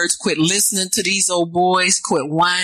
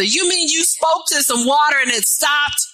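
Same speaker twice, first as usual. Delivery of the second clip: amazed shout; 7 semitones higher than usual. Real, not acted. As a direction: shout it in wonder at God. Preacher shouts incredulous question.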